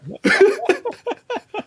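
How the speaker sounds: high-pitched fit of laughing; high laughter. Real, not acted. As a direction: crack up at your own story. Laughs after own yelled line.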